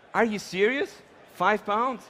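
playfully indignant double question; mock disbelief. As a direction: act lightly stunned by the bad news. Acts out reaction to the scale.

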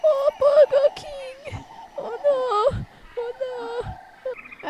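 high, drawn-out hammed-up lament; mock wailing. Hungry camper longing for Burger King, mock wailing. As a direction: wail pitifully for comfort food.

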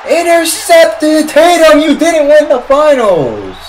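very high, drawn-out shout; high, gloating fan holler; triumphant taunting yell. Real, not acted. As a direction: yell it slowly at the rival player, stretching the words. Yelling at Tatum after interception.

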